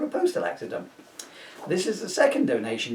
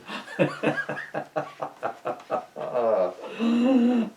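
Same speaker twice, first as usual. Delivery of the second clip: long laugh; 7 semitones higher than usual. Real, not acted.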